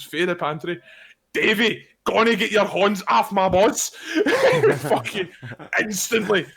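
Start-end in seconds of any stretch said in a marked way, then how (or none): 0.0-3.9 loud, raised yell imitating a mate; retold full-voice shout. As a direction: shout out your complaint like a mate shut in a pantry. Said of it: "shouting at the top of his lungs".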